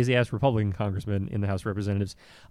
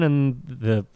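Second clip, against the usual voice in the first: usual speaking voice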